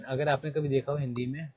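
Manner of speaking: ordinary delivery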